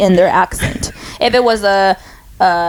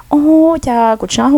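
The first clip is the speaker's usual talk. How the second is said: lightly imitating mother chatting to herself, higher pitched; a hint of mom praising herself as she works; higher, lightly voiced mother self-talk; mother's self-talk; 6 semitones higher, slower than usual. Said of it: "talk to herself in Hmong and she'll be like".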